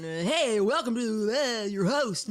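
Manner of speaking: mocking show-host impression, slow and singsong; Tyler. Teasing Tyler's show intro, mocking host impression. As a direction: put on a goofy intro voice, words dissolving into mumble. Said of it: "Tyler's got his voice going on".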